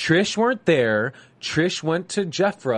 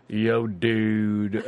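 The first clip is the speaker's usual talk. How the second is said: put-on California surfer accent; 5 semitones lower than usual. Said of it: "I can't even do it well".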